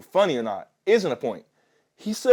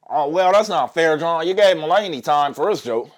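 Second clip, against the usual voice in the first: mock critic; 4 semitones higher, 5 dB louder than usual. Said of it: "So that you can't say".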